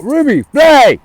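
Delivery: loud, high shout to a dog; calling out. Real, not acted. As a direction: yell the dog's name sharply. Calling back the loose dog.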